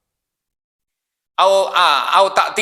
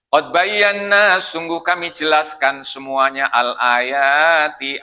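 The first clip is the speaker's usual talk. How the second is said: very slow, word-by-word explanation; preacher unpacking the verse; slow exegesis; 4 semitones lower, much slower than usual. Acted. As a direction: lay each word out slowly.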